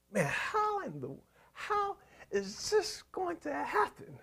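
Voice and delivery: breathless, hushed whisper of a worried believer; despairing whisper. Acted. Preacher voicing a worried believer, hushed despairing whisper. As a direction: whisper it as if the situation is hopeless.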